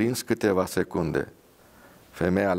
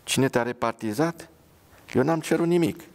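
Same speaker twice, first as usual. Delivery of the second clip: indignant protest; 6 semitones higher than usual. Acted. Soldier protests angrily.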